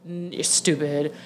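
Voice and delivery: low, soft dopey put-down; muted, low-pitched mock insult; mocking smug politician. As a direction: imitate him dismissively, pitch dropped low. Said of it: "and you're like".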